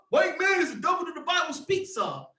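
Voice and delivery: mock preacher's shout of the false claim. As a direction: bellow their slogan back at them. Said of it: "let me calm down".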